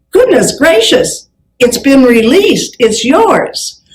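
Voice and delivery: imitated delighted surprise, high start, drawn out; amazed good news. Travel agent phoning customer about released cabin, amazed. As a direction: announce a lucky find slowly, marvelling.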